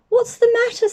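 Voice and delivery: put-on a mother checking on her sad child; mum's voice. Mum asks Jenny.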